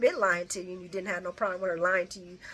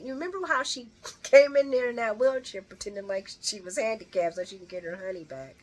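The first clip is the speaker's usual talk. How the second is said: ordinary talk, nothing marked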